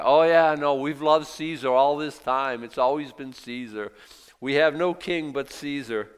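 high, loud sarcastic parody fading to plain; mocking priests. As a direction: lampoon their sudden love of Caesar. Mocking chief priests again.

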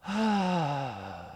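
long, heavy sigh falling away; drawn-out groaning sigh; demonstrated groan. Shown as a demonstration. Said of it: "It looks like this".

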